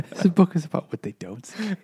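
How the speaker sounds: laughing while mocking "what they don't say"; laughing through it. Real, not acted. Joking with friends about a book, laughing. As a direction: giggle through the mock line.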